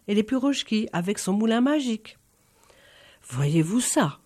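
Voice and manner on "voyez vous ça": étonnement retenu. Acted. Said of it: "s'étonna le barine".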